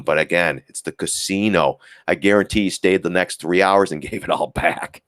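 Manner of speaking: ordinary talk, nothing marked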